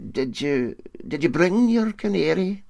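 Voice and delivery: nervous, stumbling question rising high; anxious. Acted. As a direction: ask with worried hurry. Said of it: "asked the Brodick man anxiously".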